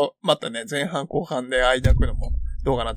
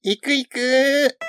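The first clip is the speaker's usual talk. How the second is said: much higher, very slow; drawn-out eager reply; 10 semitones higher, 4 dB louder, much slower than usual. Acted. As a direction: stretch it out eagerly. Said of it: "ちょっとやらせていただいてましたけど".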